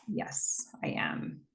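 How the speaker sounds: hushed, almost voiceless admission; tearful confession. Retold or imitated. Mother admitting the truth to her daughter, hushed and tearful. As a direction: say it very softly, holding back tears. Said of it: "And she's crying".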